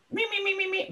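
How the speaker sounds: high-pitched squeaky "me-me-me" mimicry; mock gossipy chatter. Mimicking gossip behind people's backs.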